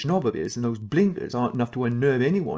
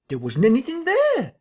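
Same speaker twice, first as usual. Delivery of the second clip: voice rising to a shriek; 13 semitones higher, 6 dB louder than usual. Acted. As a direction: pitch climbs into a shriek on "there". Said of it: "his voice running up into a shriek at the "there".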